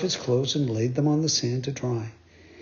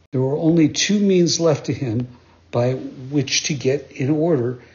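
ordinary delivery, nothing marked

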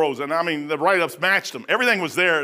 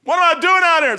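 frightened cry; 8 semitones higher, 6 dB louder than usual. Retold or imitated. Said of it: "I'm sitting there going".

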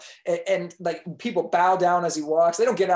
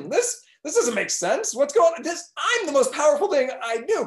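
high, louder outraged disbelief; indignant sun; 4 semitones higher, 4 dB louder than usual. Acted. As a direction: protest like someone who can't believe it.

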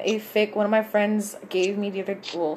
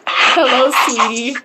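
mother voice; 4 semitones higher, 7 dB louder than usual. Acted. Voicing mother in story.

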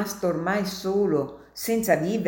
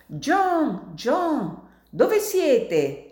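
high calls into the night for John; calling out; 9 semitones higher, much slower than usual. Acted. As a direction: shout his name, frantic and searching. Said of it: "tenda gridando".

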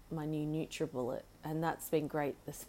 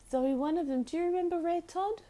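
much higher, louder, asking the parrot; high pet talk; 10 semitones higher, 4 dB louder than usual. Real, not acted. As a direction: lift it high for the bird. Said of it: "talking to the bird".

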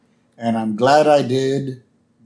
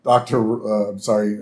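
ordinary talk

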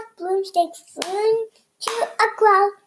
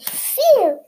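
relieved phew; 8 semitones higher, 7 dB louder than usual. A narrator's reading. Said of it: "said the dog".

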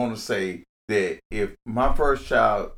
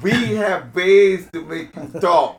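slow, higher, hammed-up German accent line; mock German accent; 7 semitones higher, 6 dB louder, slower than usual. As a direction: play a movie German interrogator with a laugh in it. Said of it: "read this in a German accent".

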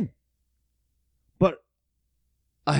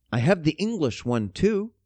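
ordinary talk, nothing marked

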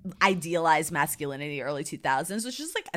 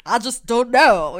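slow, louder Southern imitation; mock Southern drawl; 4 semitones higher, 9 dB louder, slower than usual. Imitating the heroine's fake Southern boy voice. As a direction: drag the words out like a Texan. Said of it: "she kinda talks like this".

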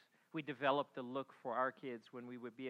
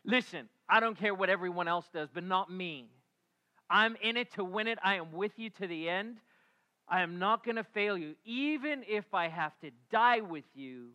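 loud, fervent promise of loyalty; passionate vow; 4 semitones higher, 7 dB louder than usual. Acted. Peter loudly vowing loyalty to Jesus, fervent and loud. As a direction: declare it loud like nothing could stop you. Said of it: "the passionate one goes".

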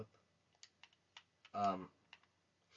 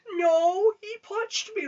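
very high, loud imitation of a sobbing little girl; mock the tattling kid in a shrill wail; whiny crying child; 10 dB louder, much slower than usual. Niece crying to the uncle that he punched her, whiny imitation.

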